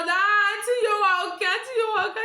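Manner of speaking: high, loud exclaiming; mock conductor. Conductor giving in to auntie, mock exclaiming.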